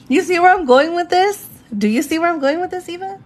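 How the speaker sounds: thrilled at your own idea; high, eager question to the viewer; excited. Real, not acted.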